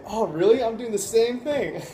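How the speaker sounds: playful, high-pitched mock surprise; joking. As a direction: kid along as if amazed by the coincidence. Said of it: "I joked around with her a little bit".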